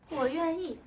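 ordinary delivery

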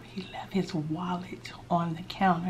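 slow unvoiced whisper. Real, not acted. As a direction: whisper so he won't hear. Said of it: "I can't talk too loud".

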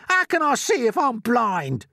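high, slow, loud indignation; shouting earthworm. A narrator's reading. Blind earthworm rebukes James, shouting. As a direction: shout each word, outraged. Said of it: "shouted the poor earthworm".

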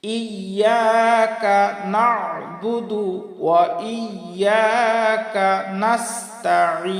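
slow "ইয়াকা নাবুদু ওয়া ইয়াকা নাস্তাইন", long vowels; full recitation. Shown as a demonstration. Teacher modelling the whole verse, slow careful recitation. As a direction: chant it slowly, pressing each ayn from mid-throat. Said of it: "হলকের মধ্য থেকে আমরা উচ্চারণ".

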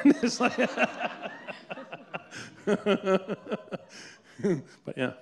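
laughing hard, fading into "but yeah". Real, not acted. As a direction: break into real laughter. Laughing at son's remark.

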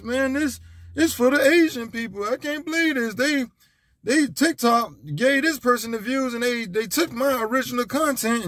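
high whiny griping, playing dumb. Mocking a creator who blames Instagram for his ban, high and whiny. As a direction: whine like a banned creator blaming everyone. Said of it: "That's my dumb, dumb voice".

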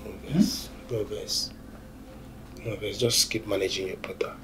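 unvoiced soft jab; whispered tease. Friend whispering a teasing plea to his mate.

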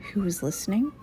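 ordinary delivery

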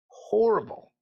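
a high, cracking, broken cry on the word; real grief catching in the throat; voice breaking. Real, not acted. Recalling the mother's scream.

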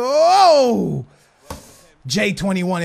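ordinary talk, nothing marked

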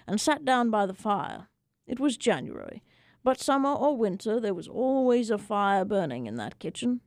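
ordinary delivery, nothing marked

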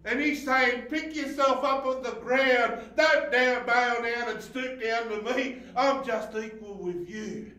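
high, loud impassioned preaching; preaching at full force. Acted. Preacher crying out Jesus's words to the church. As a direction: preach it out to the back row.

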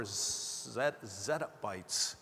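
slow, halting words with a lisp. Real, not acted. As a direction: trip over the s in zettabytes with a lisp. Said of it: "for the lisp there".

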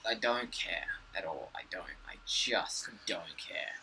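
fading from soft to whispered. Real, not acted. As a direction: let it die into a whisper.

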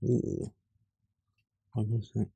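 usual speaking voice

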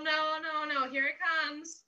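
usual speaking voice

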